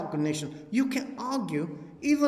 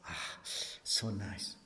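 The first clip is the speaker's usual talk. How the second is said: soft, much lower, dreamy mock contentment; mock blissful sigh; 11 semitones lower, 12 dB quieter, slower than usual. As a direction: sigh it out like a smug meditator. Mocking blissful Zen meditators.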